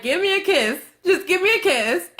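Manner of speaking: high, needy begging for affection; mock pleading. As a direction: beg pitifully, hammed up. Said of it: "making the ugliest face".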